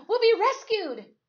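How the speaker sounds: high, loud cry of relief; excited shout. A narrator's reading. Boy shouts to his cat as plane circles, excited shout. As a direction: shout it with wild joy. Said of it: "I shouted at Stu Cat".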